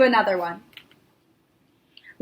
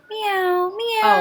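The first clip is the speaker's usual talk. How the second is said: high, soft cat meows; 8 semitones higher, 4 dB quieter than usual. Shown as a demonstration. Teacher demonstrating soft high meows for the kids. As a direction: meow gently like a kitten. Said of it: "meow like a cat".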